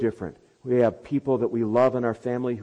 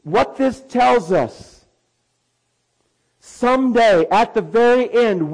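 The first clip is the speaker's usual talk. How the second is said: high, loud, slowed climactic proclamation; preaching at full force; 10 semitones higher, 11 dB louder, slower than usual. Acted. Preacher describing final judgment to believers, loud and exalted. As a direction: build to the grandest worship service.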